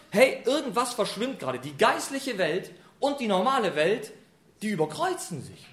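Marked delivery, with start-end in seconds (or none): none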